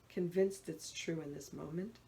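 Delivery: ordinary delivery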